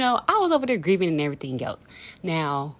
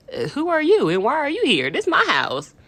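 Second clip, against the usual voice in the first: sassy retort; 7 semitones higher, 6 dB louder than usual. Retold or imitated. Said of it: "I looked at him and I'm like".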